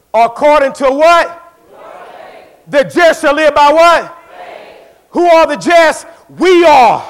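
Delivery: loud, high, slow questions to the congregation; preaching call-out. Acted. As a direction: pull the answer from the crowd.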